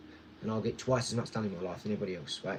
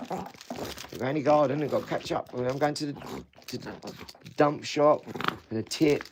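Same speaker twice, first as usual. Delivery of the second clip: usual speaking voice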